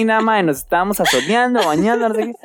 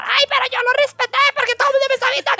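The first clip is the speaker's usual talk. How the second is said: much higher, fast mock yell. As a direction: squeal it out fast like a panicked friend. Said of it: "ella gritaba". (grito imitado, muy agudo y rápido)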